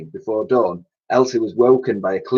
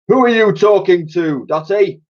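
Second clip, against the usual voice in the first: louder, higher booming boss; a man booming through the door; 11 semitones higher, 6 dB louder than usual. Acted. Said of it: "boomed a voice".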